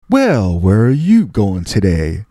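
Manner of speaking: slow drawn-out friendly greeting, swooping pitch; Jim Brown. Retold or imitated. Star greeting terrified kid in elevator, slow and friendly. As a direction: stretch each word out like an easygoing big star. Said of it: "And he's like".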